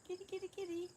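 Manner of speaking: high-pitched, soft kitty call; calling a cat. Real, not acted. Owner calling the cats over, high sing-song call. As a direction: coo for the kitty in a high little voice.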